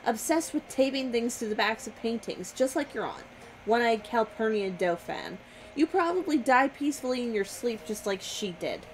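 normal talking voice

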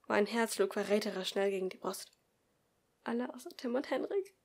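ordinary delivery

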